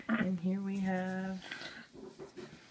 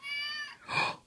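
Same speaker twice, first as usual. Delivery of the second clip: delighted high gasp; 3 dB quieter than usual. Real, not acted.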